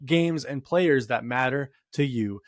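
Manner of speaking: loud, upbeat sponsor read. Acted. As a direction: sell it like a radio spot. Reads sponsor ad.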